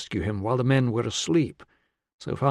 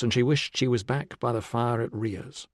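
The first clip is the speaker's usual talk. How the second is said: ordinary delivery, nothing marked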